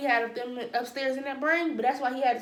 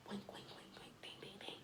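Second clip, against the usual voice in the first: whisper; 25 dB quieter than usual. Acted. Whispered aside about Cindy.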